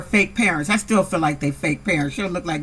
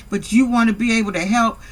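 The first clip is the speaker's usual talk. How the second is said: ordinary talk, nothing marked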